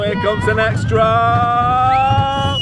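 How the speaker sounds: high, slow, building anticipation; drawn-out anticipation. Real, not acted. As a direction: stretch it out as the drop nears. Anticipating coaster drop.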